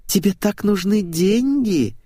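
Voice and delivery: high, slow coo; tender cooing. A narrator's reading. Blonde nestles up to the narrator, tender cooing. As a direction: coo it sweetly, pitched well up. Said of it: "нежно проворковала блондинка".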